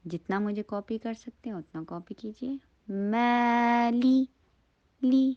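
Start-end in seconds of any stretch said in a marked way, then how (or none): 2.9-5.3 مالی long "maa", then clean "lee"; drawn-out recited "maa-lee" on مالی; clear ee sound. Shown as a demonstration. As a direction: say مالی slowly, higher, ending in "ee". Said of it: "لی کی sound آئے".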